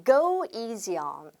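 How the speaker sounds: slow, careful "go easy on"; drawn-out teaching demo; slow model phrase. Shown as a demonstration. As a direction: lay out each word for the class to copy. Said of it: "쓸 수 있는 표현".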